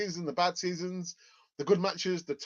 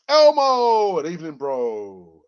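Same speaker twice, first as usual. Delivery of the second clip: loud greeting; 14 dB louder, slower than usual. Real, not acted. Greeting a viewer loudly.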